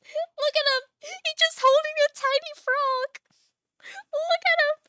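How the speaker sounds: very high, loud squeal of delight; real high-pitched laughing glee; gleeful gushing. Real, not acted. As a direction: squeal it, overcome by cuteness. Gushing over tiny frog.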